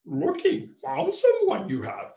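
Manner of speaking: high-pitched, slow creature impression; Yoda. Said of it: "I've been working on my Yoda".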